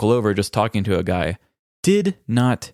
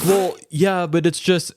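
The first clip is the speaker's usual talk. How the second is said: pitched up, stammering mock voice; flustered weirdo; 8 semitones higher, slower than usual. Weird coworker caught out, flustered high mock voice. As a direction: fumble for words like the oddball.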